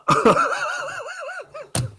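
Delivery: long, high laughing burst; helpless laugh. Real, not acted. Cracking up over the accent theory, long high laugh. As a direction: crack up completely.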